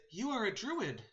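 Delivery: high sparrow voice; sparrow messenger. Acted. Sparrow addressing Birdie the druid, high voice. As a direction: speak high, as a little talking bird.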